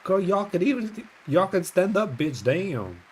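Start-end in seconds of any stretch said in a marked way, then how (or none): none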